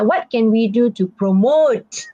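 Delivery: slow question leaning on "promote"; stress on promote. Shown as a demonstration. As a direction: stretch and weight the final "promote". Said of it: "that part will be louder".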